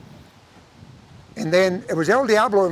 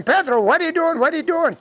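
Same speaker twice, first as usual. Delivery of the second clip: pitched way up, half-yelled alarm; mock hollering; 9 semitones higher than usual. Sinners yelling at Pedro in hammed-up alarm. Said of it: "they're screaming and hollering".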